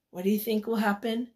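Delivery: ordinary delivery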